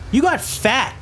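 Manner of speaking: lightly voiced blunt Jay, high and squinting; a hint of a blunt neighbour's flat verdict; quoting Jay. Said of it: "and he said".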